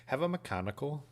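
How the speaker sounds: high-pitched mock English accent; bad English accent. Host teasing a critical viewer, in a hammed-up English accent. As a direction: ask it in a playful, badly faked English accent. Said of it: "you don't like my English accent?".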